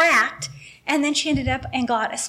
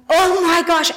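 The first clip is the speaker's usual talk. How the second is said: higher, louder burst of alarm; alarmed gasp; 6 semitones higher, 7 dB louder than usual. Retold or imitated. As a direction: blurt it out in sudden panic. Mother mumbling on phone.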